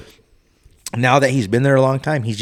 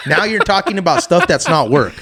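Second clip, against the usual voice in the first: irritated retort; 6 semitones higher, 6 dB louder than usual. Retold or imitated. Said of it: "I got pissed finally".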